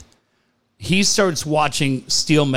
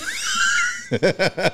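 burst of laughter; 3 dB quieter than usual. Real, not acted. Laughing at own story.

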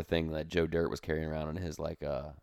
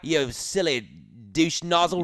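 mock British accent; 7 semitones higher than usual. A buddy keeps up the joke British accent while trading insults. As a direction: sneer it like a silly Brit, pitched up. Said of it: "Who invited the British guy".

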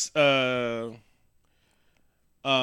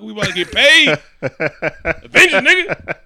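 hyped mock yell; 7 semitones higher, 12 dB louder, slower than usual. Voicing Natasha hyping the movie.

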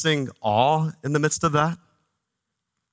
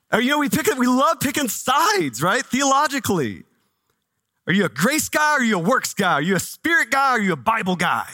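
higher, energetic teasing questions; animated preaching; 6 semitones higher than usual. Acted. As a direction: fire off the side-picking questions. Preaching on picking sides.

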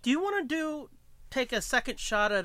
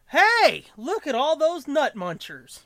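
excited call; 7 semitones higher, 7 dB louder, slower than usual. Retold or imitated. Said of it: "I was just like".

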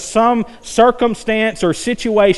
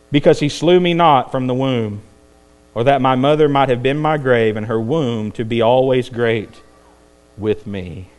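bleak, lowered preacher's lament; acted despair; 6 semitones lower than usual. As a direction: let the lament sink down to almost nothing. Acting Jeremiah wishing he was never born.

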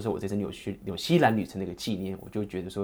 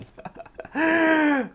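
high burst of laughter; 7 dB louder than usual. Real, not acted. Laughing mid-story.

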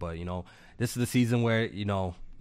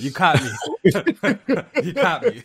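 laughing, louder; 7 semitones higher, 7 dB louder than usual. Real, not acted. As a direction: caught out, cracking up. Laughing at the tease.

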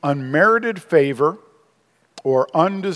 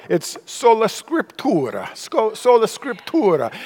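sola scriptura rolled out in a hammed-up Italian accent, a bit higher; mock Italian accent; 6 semitones higher than usual. Pastor mocks the Italian website voice, hammed-up Italian accent. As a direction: play the Italian pronunciation website voice. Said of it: "the voice has a real heavy Italian accent".

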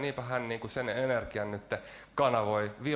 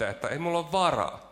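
ordinary talk

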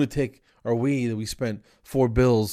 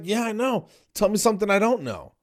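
high, fed-up sarcasm; exasperated retort; 10 semitones higher than usual. Retold or imitated. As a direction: snap back at a clueless caller.

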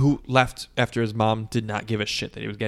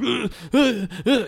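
high strangled ugh ugh ugh; shocked stammer; 11 semitones higher, slower than usual. Retold or imitated. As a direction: choke on the words in shock. Jimmy in shock, can't make words.

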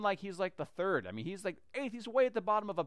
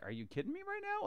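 much higher, thinking in disbelief; a prophet's stunned private thought, pitched way up; incredulous inner voice; 14 semitones higher, 5 dB quieter than usual. Retold or imitated. Samuel thinking to himself in disbelief, pitched high. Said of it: "thinking to himself, like".

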